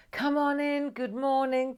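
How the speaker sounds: high, bright-pitched chanted greeting; high held notes, calling everyone in; sing-song welcome. Real, not acted. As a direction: open the show with a sung-out call. Opens the show, welcomes viewers.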